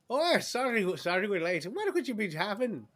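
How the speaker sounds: high, loud rambunctious barmaid; hammed-up shrill tavern hostess; shrill yelling barmaid. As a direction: call out shrilly, all bustle. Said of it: "a kind of a shrill voice yell".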